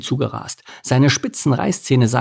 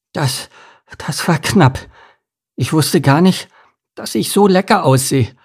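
high, loud and out of breath; breathless after a narrow escape; panting; 6 semitones higher, 4 dB louder than usual. A narrator's reading. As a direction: pant it out in excited bursts. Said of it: "keuchte Lars".

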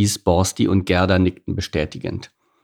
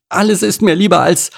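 high, loud, horrified protest; dramatic dread; 12 semitones higher, 5 dB louder than usual. A narrator's reading. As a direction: recoil in horror, voice pitched right up. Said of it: "schauderte noch einmal vor Entsetzen".

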